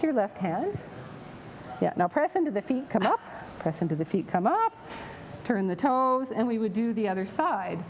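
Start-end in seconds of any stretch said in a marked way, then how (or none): none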